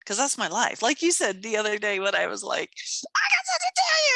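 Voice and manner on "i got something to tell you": very high, bursting-to-tell squeal; excited. Retold or imitated. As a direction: imitate yourself squealing with news. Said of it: "I feel so excited".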